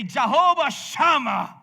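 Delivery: "Jehovah Shammah" called out slow and loud; proclaiming. Shown as a demonstration. Preacher showing the congregation what to declare, loud slow proclamation. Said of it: "you can say".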